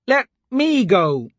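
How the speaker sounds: put-on high, slow, pleading cry of a scared girl; girl crying out in fear; frightened cry. A narrator's reading. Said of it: "Elise cried".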